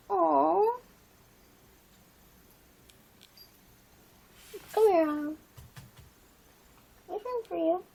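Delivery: high cooing voice for the pet; pet talk. Real, not acted. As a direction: coax the pet sweetly. Talking sweetly to pet Autumn.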